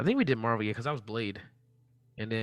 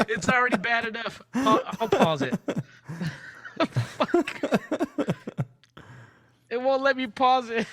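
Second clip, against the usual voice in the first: high, loud protest through laughter; laughing dismay; 11 semitones higher, 4 dB louder than usual. Real, not acted. Streamer unable to pause it, real laughing dismay. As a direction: helplessly laugh the words out, pitched up.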